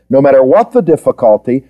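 normal talking voice

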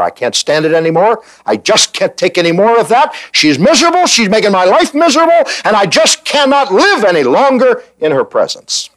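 put-on high, pleading cry of a desperate servant girl; rising, strained voice of a runaway maid; Hagar in distress. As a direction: plead it up high, close to tears. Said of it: "And she said".

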